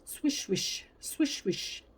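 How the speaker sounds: high unvoiced swishing; whispered swish. A narrator's reading. Brush sound effect words.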